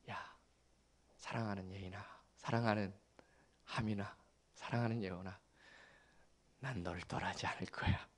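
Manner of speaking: soft bedside murmur. Retold or imitated. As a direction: murmur so you don't wake them.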